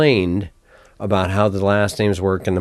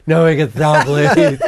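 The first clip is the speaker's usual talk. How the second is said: laughing, louder pleading; laughing plea; 5 semitones higher, 8 dB louder, slower than usual. Retold or imitated. Friends pleading to stop podcasting, laughing. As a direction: beg them to stop through laughter. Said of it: "You guys are like".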